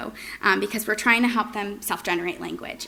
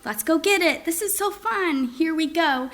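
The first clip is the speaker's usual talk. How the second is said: high, bouncy play voice; playful excited; 8 semitones higher than usual. Shown as a demonstration. Parent modeling fun phrases to a child at play, high and excited. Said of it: "modeling things like".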